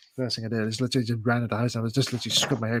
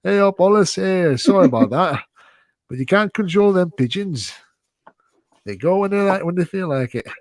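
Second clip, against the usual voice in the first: Wallace impression; 7 semitones higher, slower than usual. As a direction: play the cheerful, chatty Wallace, pitched up. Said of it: "He's like".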